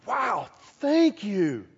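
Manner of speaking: grateful awe. Retold or imitated. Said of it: "you're like".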